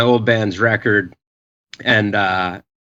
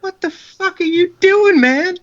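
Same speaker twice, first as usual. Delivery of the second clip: incredulous yell. Retold or imitated. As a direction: yell at him in disbelief. Speaker shocked at friend's surrender.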